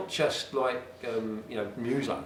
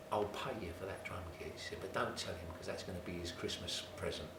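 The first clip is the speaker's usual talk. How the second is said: much softer, hushed wife's words; quiet; 5 semitones lower, 9 dB quieter than usual. Retold or imitated. Wife quietly arranging a surprise present, hushed. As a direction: keep it hushed, a secret. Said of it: "she spoke so quietly".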